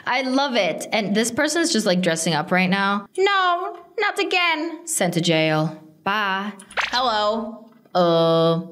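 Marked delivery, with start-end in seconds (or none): 3.2-4.9 high whiny no, not again; mock despair. Real, not acted. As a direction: wail it like a mock tragedy. Someone dressing up again.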